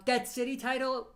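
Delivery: ordinary talk, nothing marked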